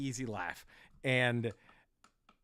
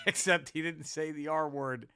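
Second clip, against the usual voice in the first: normal talking voice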